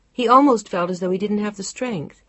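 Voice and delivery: ordinary delivery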